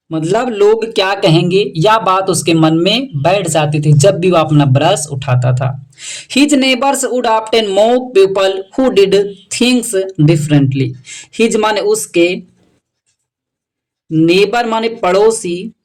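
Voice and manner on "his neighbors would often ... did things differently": very slow, word by word English read. Shown as a demonstration. Teacher reading the English sentence slowly to students. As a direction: spell the sentence out slowly for learners. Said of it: "teacher reads English line".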